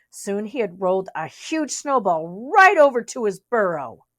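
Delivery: ordinary delivery, nothing marked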